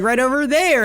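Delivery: high, mock call after a flying ring; a playful high yelp. Chasing the ring that flew off, a high mock call. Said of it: "I'd be like".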